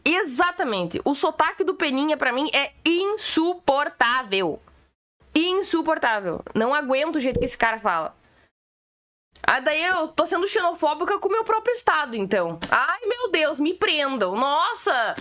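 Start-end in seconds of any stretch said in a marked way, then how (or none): 2.5-6.4 "insuportável" drawn out syllable by syllable; slow, emphatic stress on every syllable of "insuportável"; emphatic disgust. Real, not acted. Venting about an unbearable accent. 12.7-15.1 sarcastic, drawn-out melodramatic outcry; hammed-up fake alarm; mock horror. Sarcastic reply to xenophobia charge.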